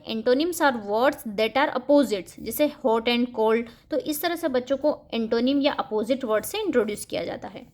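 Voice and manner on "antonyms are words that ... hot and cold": very slow, word by word; slow, clear dictation pace; slow example read. Shown as a demonstration. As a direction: read it out slowly for children. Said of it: "इस तरह से बच्चों को antonym पढ़ाया जाता है".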